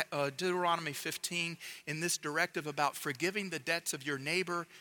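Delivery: ordinary delivery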